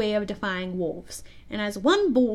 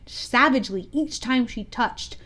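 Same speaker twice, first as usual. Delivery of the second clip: ordinary delivery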